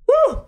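high loud yelp from spice; pained whoop. Real, not acted. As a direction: whoop out at the heat. Burning from hot wing.

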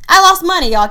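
high, loud confession of losing money; loud admission. Real, not acted. Vlogger confessing her own losses, loudly. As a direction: belt out that you lost money.

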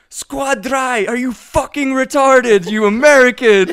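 put-on high, loud German-accented scolding; mock angry German player. German gamer berating the American on voice chat, mock exasperation. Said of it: "They're like".

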